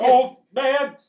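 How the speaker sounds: hammed-up quote from a space dogfight; Star Wars pilot quote. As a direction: belt out the end of the movie line. Quoting a Star Wars line.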